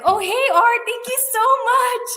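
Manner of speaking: high, loud gushing thanks; excited gushing. Retold or imitated. [name] thrilled to hear from Art again, high and loud. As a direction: gush it out high and bright. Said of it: "I'm like".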